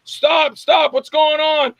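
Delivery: high, loud, drawn-out alarmed cry; panicked shout. Retold or imitated. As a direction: yell at the fake agent to stop. Crowd panics at fake arrest.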